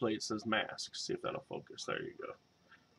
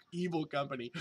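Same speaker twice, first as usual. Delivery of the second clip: ordinary talk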